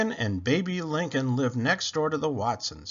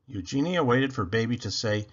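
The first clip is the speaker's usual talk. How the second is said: plain reading voice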